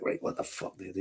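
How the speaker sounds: under the breath, unvoiced; hushed panicked aside; whispered aside. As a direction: mutter it to yourself in panic. Retold thought at flat tire.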